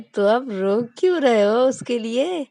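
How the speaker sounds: slow, loud probing; teasing question. Acted. Rahul probing Vikas about crying for Rani, slow and loud. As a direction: push him on why he cries.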